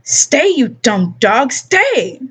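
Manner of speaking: slow then high snapped stay; angry command. Acted. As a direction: threaten the dog. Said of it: "cocked it like a baseball bat".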